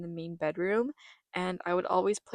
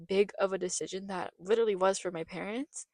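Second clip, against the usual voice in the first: ordinary delivery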